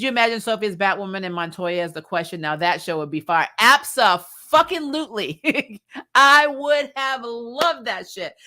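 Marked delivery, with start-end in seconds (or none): 3.6-5.8 drawn-out, higher, louder gush; excited emphasis. Real, not acted. Gushing over dream show idea. 6.0-8.3 slow, higher, louder laugh through the words; laughing delight. Real, not acted. Laughing about dream show idea.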